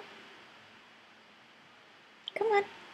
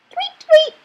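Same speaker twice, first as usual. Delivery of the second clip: bird chirp imitation; 13 semitones higher, 8 dB louder than usual. Imitating bird sounds to parrot.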